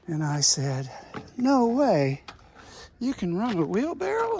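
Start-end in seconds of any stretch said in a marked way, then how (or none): 1.4-4.4 mock amazement. Retelling his mock amazement.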